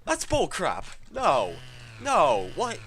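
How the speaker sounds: loud, fed-up refusals; angry protest. Real, not acted. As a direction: reject it with loud noes. Frustrated at game.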